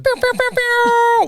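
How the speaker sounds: high, loud pew pew like an air horn; imitated horn blast. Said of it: "What's that thing that they do?".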